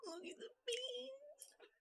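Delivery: very soft, high, drawn-out coo; hushed squeal; tiny whisper. Real, not acted. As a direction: whisper it slowly in delight. Cooing over the beans.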